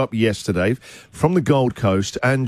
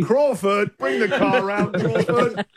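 lordly high-pitched summons; posh butler-caller voice; 6 semitones higher, slower than usual. Pretend. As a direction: call for your butler like an aristocrat. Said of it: "He sounds like a butler from Downton Abbey".

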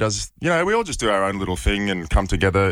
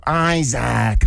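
Isaac called out a bit higher and stretched, American arena style, a mangled EYE-zak; mock American announcer; 4 semitones higher than usual. Player mimics Kentucky announcer botching his name Isaac, stretched and higher. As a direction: imitate a US arena announcer trying to say Isaac the Aussie way. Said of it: "the announcer would go".

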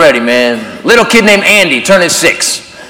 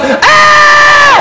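put-on kid scream. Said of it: "he goes".

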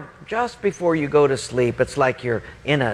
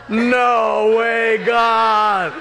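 long, high, loud cry of refusal; hammed-up wail of protest; mock protest shout; 8 semitones higher, 5 dB louder, much slower than usual. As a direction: holler it out in comic horror, vowels stretched. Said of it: "And I thought".

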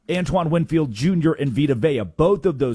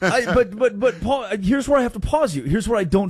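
excited interruption; 8 semitones higher than usual. Real, not acted. Cuts in laughing, high pitch.

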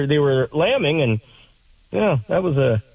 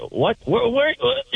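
high, stammered repeats; mock confused stutter, pitched up; 11 semitones higher, slower than usual. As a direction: imitate a man stuttering on one word. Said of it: "He's stuttering".

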